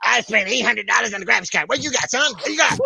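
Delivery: bragging gamer guy. Retold or imitated. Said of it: "some guy goes".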